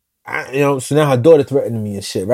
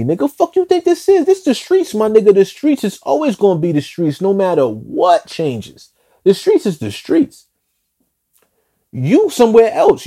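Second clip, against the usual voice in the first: furious retort; 6 semitones higher than usual. Retold or imitated. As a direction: voice jumps high, heated disbelief. Heated retort on the phone.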